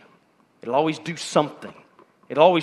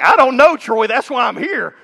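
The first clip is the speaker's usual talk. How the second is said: high, loud, half-laughing protest; playful blurted reply; 8 semitones higher, 8 dB louder than usual. Pretend. College student answering the pastor with a loud, laughing protest. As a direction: blurt it out like a stumped student caught off guard.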